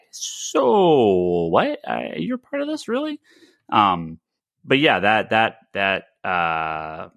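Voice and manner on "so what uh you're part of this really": incredulous challenge. Retold or imitated. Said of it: "talk to him and be like".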